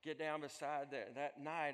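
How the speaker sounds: ordinary delivery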